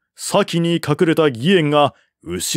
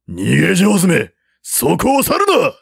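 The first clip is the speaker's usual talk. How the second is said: angry yell; 7 semitones higher than usual. A narrator's reading. General yelling after the fleeing officer, angry shout. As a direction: bellow after the runaway.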